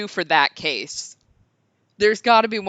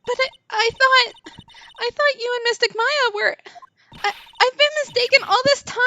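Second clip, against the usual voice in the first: put-on high gasping girl, stammering in dismay; a child whose hopes just broke; shocked little girl. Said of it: "break an eight-year-old's heart".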